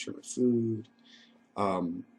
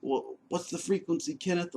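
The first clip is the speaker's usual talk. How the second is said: higher, sing-song mock of a rambling brother; imitating his brother; 8 semitones higher than usual. Imitating his delusional brother greeting him: higher mock voice. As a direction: play the brother, eager and off-kilter, pitched up. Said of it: "and he's like".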